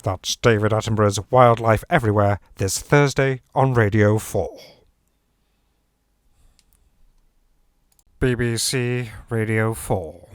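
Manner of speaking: Radio Four announcer closing the trailer; announcer read. Acted. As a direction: close out the trailer like continuity. Trailer outro and station ident.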